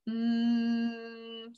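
"Mm" as a soft humming m; m sound. Shown as a demonstration. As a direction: hum the m sound softly. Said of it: "what does M say".

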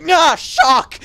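sudden high, loud outburst; mimicked shock attack. Gamer imitating a shock spell, loud mock attack. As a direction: burst out like a jump scare. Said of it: "It's like".

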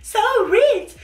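high, slow, cutesy cooking-game voice; Cooking Mama impression. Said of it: "es el Cooking Mama". (imitando a Cooking Mama con voz aguda y lenta)

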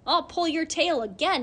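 put-on angry boy shouting at the cat. A narrator's reading. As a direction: yell it at the cat. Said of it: "he shouted".

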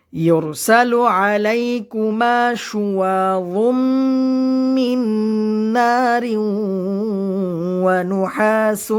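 slow, melodic Quran recitation. Shown as a demonstration. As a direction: chant each word slowly with long vowels. Teacher recites Quran verse.

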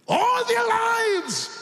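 very high, slow, loud cry; drawn-out sermon shout; preaching shout. Real, not acted. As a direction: stretch it out for the crowd. Preacher stresses the miracle.

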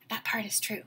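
soft confiding whisper; whispered aside. Real, not acted. Teacher whispering an aside to her class mid-story.

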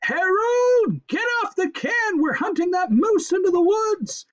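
very high, bossy screech; loud, piercing housewife holler; yelled. A narrator's reading. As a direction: screech orders at your husband. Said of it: "yelled Missus Blevins's".

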